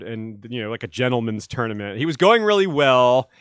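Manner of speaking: usual speaking voice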